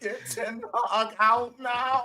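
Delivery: high, whining fan at the end of his tether; [name]. Acted. Impersonating a fan sick of the manager, high defeated whine. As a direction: plead in a high, worn-out wail. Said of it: "Yeah, that's the one".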